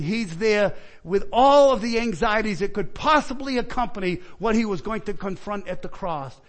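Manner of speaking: ordinary delivery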